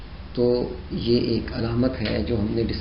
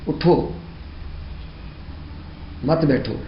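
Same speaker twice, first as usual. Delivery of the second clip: اٹھو raised, long stop, then مت بیٹھو falling; pause after اٹھو; 3 semitones higher, 4 dB quieter than usual. Shown as a demonstration. Teacher showing the command to get up, with a pause after اٹھو. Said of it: "اٹھو پر ٹھہرنا لازم ہے".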